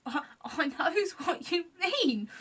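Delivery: slow, high, giggly creaker; giggling. Acted. Sniff agreeing with Scratch, giggling. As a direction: giggle through it. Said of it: "started giggling".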